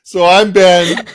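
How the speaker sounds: teasing sign-off. Real, not acted. Host closing the show while co-host cries, teasing loudly. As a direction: boom it out over the co-host's tears.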